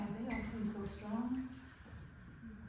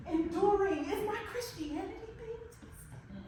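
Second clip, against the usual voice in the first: rising anguish; 5 semitones higher, 5 dB louder than usual. Acted. Anguished questioning of God.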